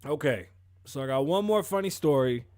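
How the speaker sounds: usual speaking voice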